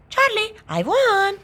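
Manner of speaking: put-on high, gleeful child voice; triumphant little sister. A narrator's reading. Little sister ignores cheating charge, triumphant. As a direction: brush him off with a crow. Lola brushes off accusation, claims win.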